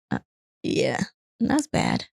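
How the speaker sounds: ordinary delivery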